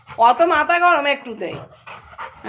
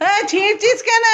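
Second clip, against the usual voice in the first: startled scold; 8 semitones higher than usual. Real, not acted. Scolding pet for tearing.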